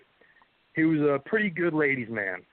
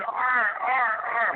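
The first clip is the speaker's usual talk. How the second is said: higher mock pirate growls, arr arr arr; 6 semitones higher, much slower than usual. Teller acting out the hopping pirate in his joke, mock arrs. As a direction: hop on one leg and cry arr like a hurt pirate. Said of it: "hopping on one leg, going".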